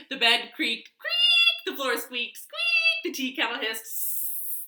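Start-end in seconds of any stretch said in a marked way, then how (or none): 1.0-1.7 high creak. Pretend. Creak effect. 2.4-3.0 high squeak. Pretend. Squeak effect.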